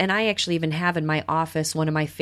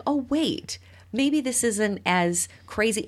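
higher then slower, lightly acted dawning kid; kid's realization; 6 semitones higher, slower than usual. Kid realizing the monster is not scary, higher then slow. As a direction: let it slowly dawn.

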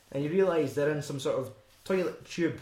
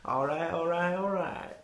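high, hammed-up drawled all right; southern accent; 4 semitones higher than usual. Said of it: "That's enough butchery".

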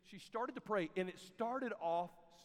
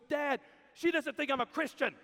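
higher, louder little girl voicing a hurt complaint; upset little girl; 6 semitones higher, 8 dB louder than usual. Little sister running to dad, upset, in a higher, louder voice. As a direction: play the hurt younger sister, a bit raised.

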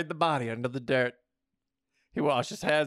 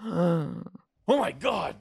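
high, loud "oh my God" shock; startled, high outburst; exclaiming; 5 semitones higher, 4 dB louder, much slower than usual. A narrator's reading. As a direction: exclaim it with a raised voice. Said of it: "David exclaimed".

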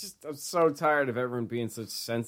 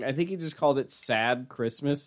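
normal talking voice

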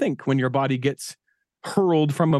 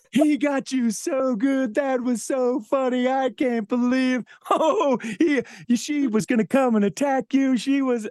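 imitating a dad cracking up, higher and slow; mock laughter, voice pitched up; laughing; 10 semitones higher than usual. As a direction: retell his giddy laughing line. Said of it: "starts laughing so hard".

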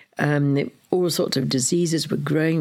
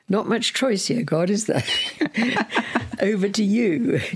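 wry laughing prayer; 6 semitones higher than usual. Real, not acted. Joking wryly to God in the dark.

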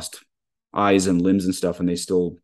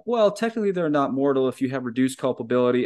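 lightly voiced modernist, pitched higher; a slightly higher, quibbling "well, technically" voice; hair-splitting modernist; 4 semitones higher than usual. Priest mimics a modernist excusing mortal sin, lightly mocking. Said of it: "The modernist is gonna look at that and be like".

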